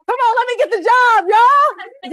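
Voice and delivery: bold, energetic pitch for the job; eager candidate. Shown as a demonstration. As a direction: pump it up like you want it. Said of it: "Do you see the difference?".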